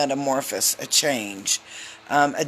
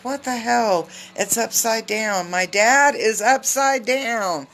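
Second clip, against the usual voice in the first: mock shocked; 7 semitones higher, 3 dB louder than usual. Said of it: "and I'm like".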